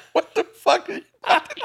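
high, laughing question; laughing disbelief. Retold or imitated. Asking the old lady, laughing in shock. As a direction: squeal it through laughter. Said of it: "I'm like".

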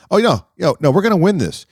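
high, loud, eager; confident insistence. Retold or imitated. Golfer assuring his pro partner they'll win, excited and loud. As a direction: sell it hard, he really means it.

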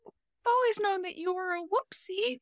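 high, put-on voice of doting pirates; mock crew voice. Pirates coo to their captain in a high put-on voice. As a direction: coo at him like a fond crew.

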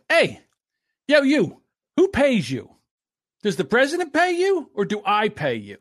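loud, high mock challenge, easing slightly; DeSantis confronting. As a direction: imitate a boss calling out defiant staff. Acting out DeSantis confronting superintendents.

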